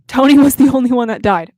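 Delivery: loud, aggrieved protest; emphatic outcry. Real, not acted. Fan protesting her favorite character's death, loud outcry. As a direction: belt it out in fan outrage. Repeats outburst after muting herself.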